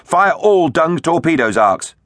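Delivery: high-pitched captain's shout, moderately loud; shouted order. A narrator's reading. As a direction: call out an urgent battle command. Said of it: "Tegs shouted".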